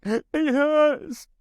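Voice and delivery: very high, loud wail of pain; sudden high yelp of hurt; pained cry. A narrator's reading. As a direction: cry out in agony, shrill and sustained. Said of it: "Kostoyulin cried".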